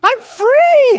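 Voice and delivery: very high, loud cry of triumph; overexcited helium shriek. Acted. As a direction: shriek it like you've sucked helium. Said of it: "[name]'s vocals shattered the ozone layer".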